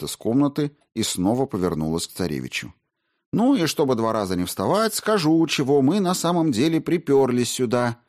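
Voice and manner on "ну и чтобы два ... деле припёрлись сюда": put-on brash young woman. Margarita speaks.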